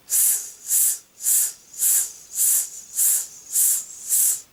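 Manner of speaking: repeated short bursts of hiss; pulsed hiss. Shown as a demonstration. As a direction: push out separate hisses using the muscles. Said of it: "you will really feel your muscles if you".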